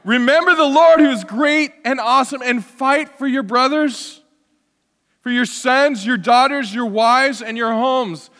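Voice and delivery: high, loud battlefield speech; rally your people to fight; rousing rally cry. Acted. Rallying speech after dropping the accent.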